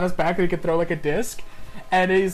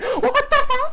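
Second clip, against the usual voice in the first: high, laughing outburst; shocked cry; slower than usual. Retold or imitated. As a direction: sputter in baffled disbelief. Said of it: "just like".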